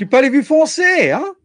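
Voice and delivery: high, teasing French question; playful French. Acted. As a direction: tease them in French, pitch up.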